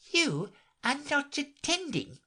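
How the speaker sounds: high, stern little scold; severe mouse. A narrator's reading. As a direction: reprimand a daydreaming girl. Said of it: "said the mouse to Alice severely".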